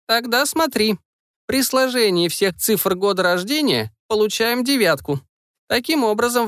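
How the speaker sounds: a hint of a wise old witch; old woman voice. Grandmother explains the numbers.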